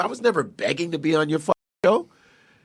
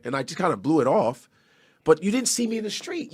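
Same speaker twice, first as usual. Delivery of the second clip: ordinary talk, nothing marked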